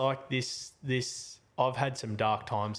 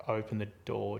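ordinary talk

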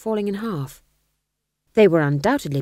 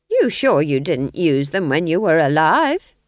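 sharp suspicion; 7 dB louder than usual. A narrator's reading. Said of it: "said Nanny sharply".

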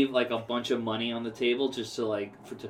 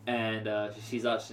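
normal talking voice

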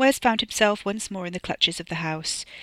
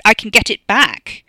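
loud, higher interruption; excited outburst; 4 semitones higher, 12 dB louder than usual. A narrator's reading. Wes interrupts Ricky about his glory, excited outburst. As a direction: burst in with sudden hope.

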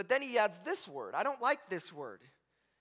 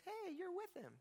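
put-on high, soft little-girl voice; imitated girl, high and hushed; 9 semitones higher, 15 dB quieter than usual. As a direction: pipe up small and high, like a child. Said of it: "a little girl comes and says".

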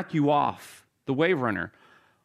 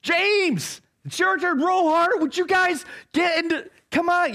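yelling; 12 semitones higher than usual. Acted. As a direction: bark orders over the storm. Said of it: "you know, just yelling".

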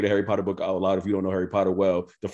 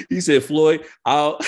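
laughing; 10 dB louder, slower than usual. Real, not acted. Laughing while retelling Fifty's dare.

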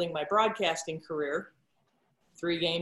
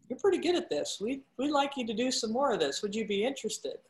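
producer's voice; 6 semitones higher, faster than usual. Retold or imitated. Producer's voice in retold story.

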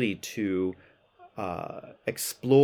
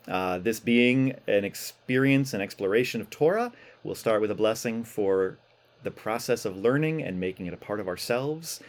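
normal talking voice

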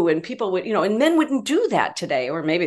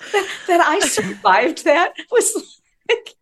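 high, slow, words broken by laughter; breaking up laughing; 10 semitones higher, 4 dB louder, slower than usual. Real, not acted. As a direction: struggle to talk through real laughter. Still laughing after the punchline.

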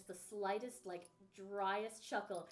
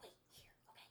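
quick secret whisper, breath only; hushed whisper; 16 dB quieter, much faster than usual. Acted. She whispers to the hidden player to wait. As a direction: whisper it so the visitor won't hear. Hiding Harry from the visitor.

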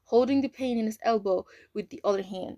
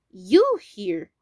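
sudden loud surprised question; surprised cry; 9 dB louder than usual. A narrator's reading. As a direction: blurt it out in shock. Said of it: "Winston cried out in surprise".

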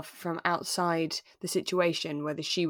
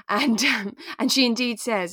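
ordinary talk, nothing marked

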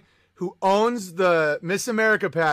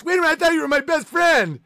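mock shocked suspect; 9 semitones higher, 3 dB louder, faster than usual. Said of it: "suspect protests".

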